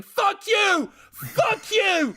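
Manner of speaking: high furious shouting; yelling. Acted. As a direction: scream it in panic. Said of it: "starts yelling".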